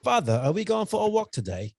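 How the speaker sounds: high-pitched, mock English accent. Dog asking its owner for a walk, mock English accent. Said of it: "if he got an English accent".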